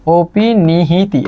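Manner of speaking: very slow, syllable-by-syllable অপিনিহিতি; spelled-out pronunciation. Shown as a demonstration. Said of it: "এটা বানানটাই মাঝে মাঝে পরীক্ষায় আসে".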